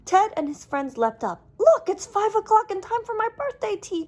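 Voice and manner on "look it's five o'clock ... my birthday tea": put-on much higher, louder excited child voice; Ted waking to the alarm, thrilled; excited cry. A narrator's reading. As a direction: burst out with delight. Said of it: "cried Ted".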